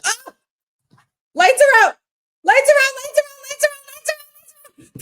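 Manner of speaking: high, loud excited shriek; excited screaming. Real, not acted. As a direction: scream it out in pure hype. Fan screaming at the blackout.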